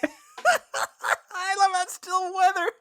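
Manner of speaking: high, slow laughing delight. Real, not acted. Cohost laughing at the recycled weather bit, laughing. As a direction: laugh through the words.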